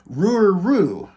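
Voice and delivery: talking-dog impression, big and swoopy; Scooby-Doo. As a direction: howl it out with R sounds up front. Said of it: "he's talking kinda like Scooby-Doo".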